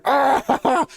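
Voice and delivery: high-pitched cry breaking into laughter; kobold whoop. Acted. Kobolds chugging moonshine, high gleeful whoop. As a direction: let out a high, laughing holler. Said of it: "You hear".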